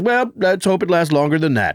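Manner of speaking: mock dry quip in a put-on voice; imitating Lorne's dry one-liner; dry boss voice. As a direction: deliver it deadpan as the boss. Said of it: "And he goes".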